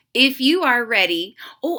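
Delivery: plain reading voice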